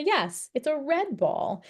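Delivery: "red" lifted high and slowed to stand out; emphasis on red. Shown as a demonstration. Parent answering a child pointing at a ball, stressing "red". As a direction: say "red" slow and high so the toddler hears the new word. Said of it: "put emphasis on that word that you're adding".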